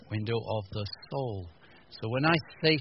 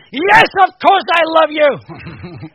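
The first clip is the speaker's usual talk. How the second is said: angry shout; 16 dB louder, much slower than usual. Retold or imitated. Angry dad shouting at kid.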